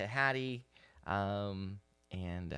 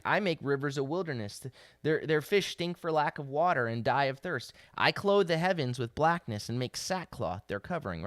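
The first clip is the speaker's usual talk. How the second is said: louder, impassioned sermon voice; fervent preaching; 3 semitones higher, 5 dB louder than usual. Acted. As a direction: proclaim it like God pleading with his people. Preaches God's words with rising force.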